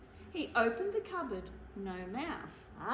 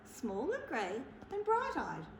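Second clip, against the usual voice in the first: ordinary delivery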